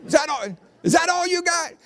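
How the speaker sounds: high Southern preacher's challenge; old Southern pastor. Retold or imitated. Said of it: "the old Southern pastor got up there and said".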